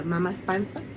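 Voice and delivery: plain reading voice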